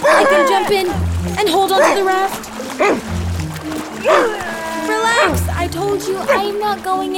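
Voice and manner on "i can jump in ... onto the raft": high, loud demonstrating call. Acted. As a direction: call up to the dog from the water. Calling from the water.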